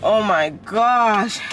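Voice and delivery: usual speaking voice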